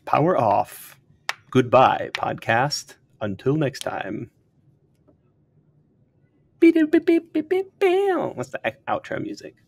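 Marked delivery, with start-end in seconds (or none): 0.0-4.3 powering down. Pretend. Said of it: "Oh, that's good, too". 6.6-8.3 high robotic beeps, much higher and louder; powering down beeps. Pretend. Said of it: "Oh, that's good, too".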